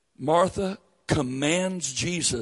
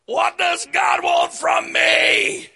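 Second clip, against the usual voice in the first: desperate cry; 12 semitones higher, 6 dB louder, slower than usual. Retold or imitated. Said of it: "burnt out preacher complaining".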